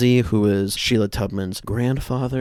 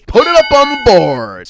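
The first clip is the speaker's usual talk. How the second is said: much louder, higher mock-excited shout; 12 semitones higher, 11 dB louder than usual. Mocking finally finding a conflict, shouted with fake excitement. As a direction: shout it like a game-show win. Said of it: "mock excitement".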